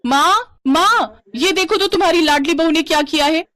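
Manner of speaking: high, loud call for mother; angry yelling. Acted. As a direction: yell in outrage to summon your mother. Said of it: "Kavya गुस्से में चिल्लाते हुए कहती है". (गुस्से में चिल्लाकर माँ को बुलाना)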